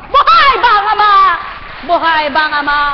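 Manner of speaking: high, loud, fast cry; preacher's loud call to the hall; shouting. Real, not acted. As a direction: fling the refrain out.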